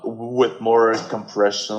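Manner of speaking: ordinary delivery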